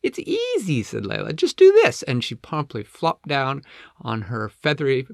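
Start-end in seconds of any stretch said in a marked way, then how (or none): 0.0-0.8 very high, chipper penguin; squeaky, breezy penguin voice; Layla. Acted. Layla shows how to slide. 1.4-2.0 very high, loud, perky penguin; Layla. Acted. Layla shows how to slide.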